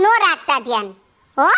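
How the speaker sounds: low-pitched, stubborn retort; low, scornful comeback; defiant dismissal. Acted. Defiant retort in acted quarrel.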